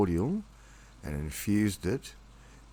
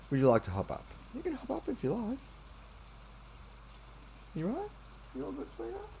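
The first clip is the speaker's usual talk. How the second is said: cooing pet talk; 5 dB quieter than usual. Real, not acted. Soothing his nervous cat sweetheart, very high, soft coo.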